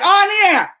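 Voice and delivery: high, loud gasp for air; gasping yell. Retold or imitated. Yelling while coming up from the pool. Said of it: "I shouldn't have yelled like that".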